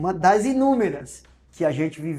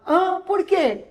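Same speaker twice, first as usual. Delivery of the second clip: whiny wife; 13 semitones higher, 4 dB louder, much slower than usual. Retold or imitated. Wife panics at checkout.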